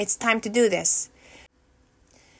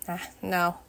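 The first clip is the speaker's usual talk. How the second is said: ordinary talk, nothing marked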